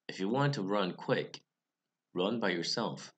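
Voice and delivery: ordinary delivery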